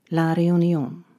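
plain reading voice

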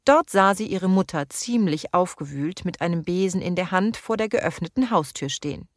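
plain reading voice

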